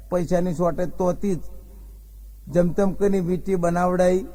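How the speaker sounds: ordinary talk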